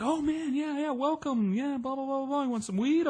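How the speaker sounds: high, easygoing stoner voice; friendly stoner. Retold or imitated. Partygoers welcome the newcomer, high laid-back stoner voice.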